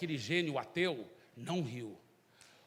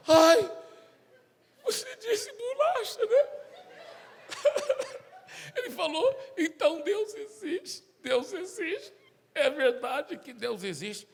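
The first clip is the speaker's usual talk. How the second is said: much higher, slow, sob-laughing voice; crying and laughing; 12 semitones higher, 6 dB louder, slower than usual. Acted. The atheist realizes God exists and breaks down, sob-laughing high. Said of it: "por que que ele tá falando desse jeito".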